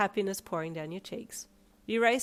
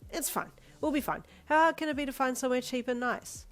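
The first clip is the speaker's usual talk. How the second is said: high, perky upbeat voice; 6 semitones higher than usual. A narrator's reading. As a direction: brighten up to cheer him. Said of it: "you perked up".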